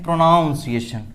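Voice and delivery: प्रोनाउंसिएशन said as pro-NOWN-see-ay-shun; common mispronunciation. Shown as a demonstration. Teacher repeating what many people say, mispronunciation. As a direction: put "noun" into the middle of प्रोनाउंसिएशन. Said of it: "क्या बोलते हैं?".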